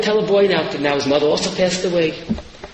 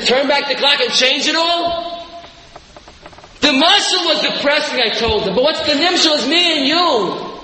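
higher, impassioned sermon cry; preaching at full force; 7 semitones higher than usual. Acted. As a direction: rouse the congregation with hope. Preaches the hopeful lesson.

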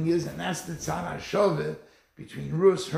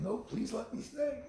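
softer, a touch of a pleading plea; mild mock begging; 7 semitones higher, 7 dB quieter than usual. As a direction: gently act out Lot begging to stay. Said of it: "Lot should have begged and begged".

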